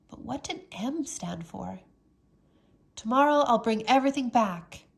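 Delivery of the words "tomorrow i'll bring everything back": louder, raised voice; loud declaration; said loudly. A narrator's reading. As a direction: announce it loudly to the woods. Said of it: "Jack said loudly".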